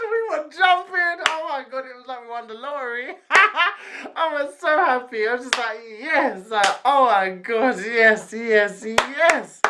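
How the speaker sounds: high, squealing delight; giddy excitement. Retold or imitated. Girl retelling jumping like they won the lottery, giddy. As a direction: relive the joy in a high squeal. Retelling how happy she was.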